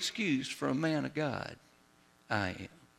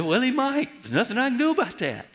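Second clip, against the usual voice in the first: higher, quick and offhand; breezy shrug; 8 semitones higher, 4 dB louder than usual. Retold or imitated. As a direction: toss it off lightly, no worry. Retold shrug to distraught wife.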